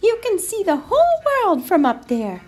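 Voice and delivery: high, eager mouse voice; excited storybook mouse. A narrator's reading. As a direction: marvel at it in a high little voice. Mouse excited about the view.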